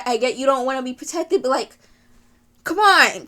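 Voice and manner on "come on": louder, higher coaxing protest; frustrated appeal to a character; exasperated plea. Real, not acted. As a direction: urge them in frustration.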